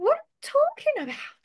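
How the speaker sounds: high, dramatic movie-line delivery; imitating a movie actor. Shown as a demonstration. Teacher shows students how to shadow a series line, hammed-up. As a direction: ham it up like a film scene. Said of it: "Aí tu vai imitar com esse jeito, assim mesmo".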